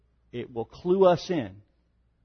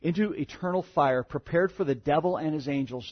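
softer, grave reading of the curse; grave scripture reading; 5 dB quieter than usual. Acted. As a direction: let the judgment sink in. Reads the curse, quieter.

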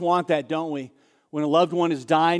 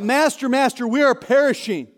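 mildly panicked cry, higher-pitched; 6 semitones higher than usual. Retold or imitated. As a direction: cry out for rescue in alarm. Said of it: "they cry out".

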